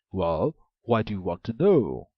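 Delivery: loud, suspicious ogre voice; the ogre suspects a trick; an ogre questions the maiden, loud and suspicious. A narrator's reading.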